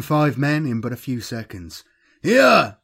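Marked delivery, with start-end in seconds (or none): 2.2-2.8 high, loud battle yell; screamed call. A narrator's reading. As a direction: scream to draw the enemy's attention. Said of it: "Al Kahan screamed".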